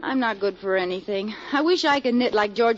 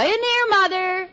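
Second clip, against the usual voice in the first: sweet call; 9 semitones higher than usual. Acted. Calling to mother-in-law.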